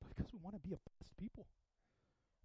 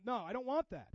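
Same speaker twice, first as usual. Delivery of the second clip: usual speaking voice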